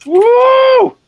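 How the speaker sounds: high loud whoop; cheering. Real, not acted. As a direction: whoop with delight. Cheering at the photo.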